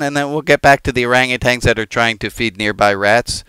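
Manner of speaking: normal talking voice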